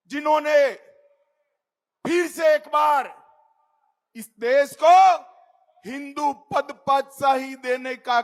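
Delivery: loud, fiery rally oratory; roaring rallying speech. Acted. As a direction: build the crowd up with pride. Fiery tribute to Shivaji.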